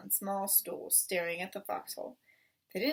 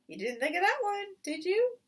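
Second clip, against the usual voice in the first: higher, mocking voice; taunting farmer; 11 semitones higher, 5 dB louder than usual. Boggis teasing Bean about the foxhole, mocking. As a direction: needle him with a smug dig.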